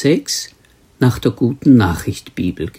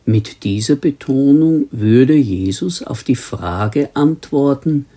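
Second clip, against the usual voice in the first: normal talking voice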